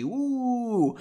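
high, drawn-out "woo"; long teasing whoop; playful woo. Real, not acted. Reader teases about a capital-L lady, whooping.

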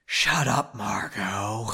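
hammed-up restrained anger, drawn out; angry but quiet. A narrator's reading. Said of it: "angrily but quietly".

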